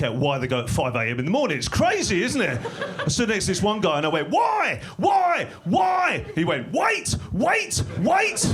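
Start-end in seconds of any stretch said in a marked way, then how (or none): 4.3-6.2 shouted in a high pitched burst; comic high-pitched yelling; high exclaimed why. Retold or imitated. Gym joke, shouting at stranger. 6.7-8.5 shouted high, word by word; comic high-pitched shouting; high yelled reply. Retold or imitated. Stranger's shouted gym answer.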